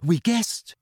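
small, high-pitched admission; apologetic. A narrator's reading. Girl to flustered friend, apologetic. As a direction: own up sheepishly. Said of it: "said Hermione apologetically".

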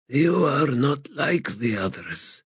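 lightly voiced, weary, bitter god; a hint of a broken ancient god; frail god voice. Crippled god speaks.

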